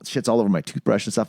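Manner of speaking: ordinary delivery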